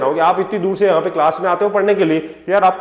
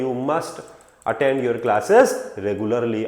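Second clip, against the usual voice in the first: slow, lower, firm parental instruction; mildly strong duty; 6 semitones lower, much slower than usual. Acted. Parents telling a student about classes, a little strongly. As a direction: say it slowly, weighting "must". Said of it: "थोड़ी सी strong duty के हिसाब से".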